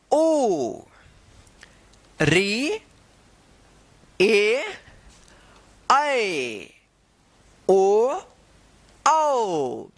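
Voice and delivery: teacher chanting vowels one by one; slow classroom vowel recital; reciting vowels. Shown as a demonstration. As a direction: call out each vowel clearly for the class. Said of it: "जैसे हम पढ़ रहे हैं".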